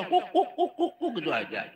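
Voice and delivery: high, loud woofs; imitated dog barking. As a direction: bark in quick comic hoots like a dog. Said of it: "Jadi anjing seketika". (menirukan gonggongan anjing, tinggi dan keras)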